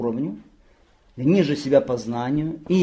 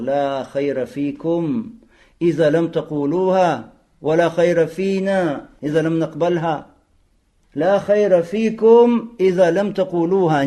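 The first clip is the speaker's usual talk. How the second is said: slow, louder recital of the saying; solemn Arabic recitation; 3 semitones higher, 5 dB louder, slower than usual. Acted. Teacher recites Umar's reply to the man, slow and solemn. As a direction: intone the Arabic words slowly and firmly.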